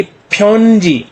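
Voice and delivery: फ्योनजी drawn out as phyon, jee; two slow syllables. Shown as a demonstration. Teacher introduces a two-syllable word, each syllable held long. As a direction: stretch both syllables of फ्योनजी. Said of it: "दो syllable से".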